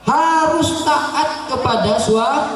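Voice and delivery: high, slow preaching call; preaching shout. Real, not acted. Preacher exhorting wives to obey. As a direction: drive the point home.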